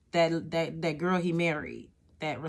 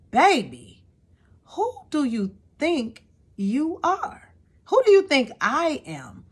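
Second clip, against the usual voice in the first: high, drawn-out who do you think you are; sassy high scoff; 7 semitones higher, 3 dB louder, slower than usual. Acted. Calling out her co-parent's wife, sassy and high. Mocking the ex's new wife.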